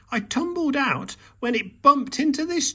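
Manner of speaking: calling. A narrator's reading. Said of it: "called Mole".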